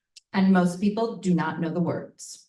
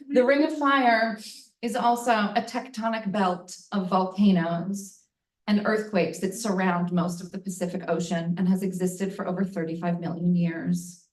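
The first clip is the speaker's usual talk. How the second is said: ordinary talk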